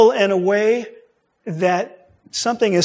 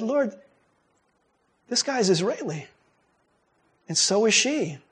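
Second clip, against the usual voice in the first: hushed, softer aside to God; inward prayer; 8 dB quieter than usual. Retold or imitated. Man silently telling the Lord his hunch, hushed inward aside. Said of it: "inside I said".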